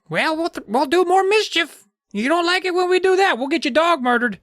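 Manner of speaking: very high gnome voice with a mock threat; tiny gnome making a petty threat; threatening squeaky gnome.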